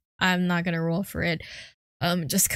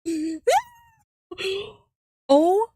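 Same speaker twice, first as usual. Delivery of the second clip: ordinary talk, nothing marked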